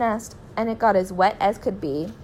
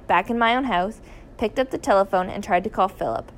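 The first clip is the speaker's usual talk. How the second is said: plain reading voice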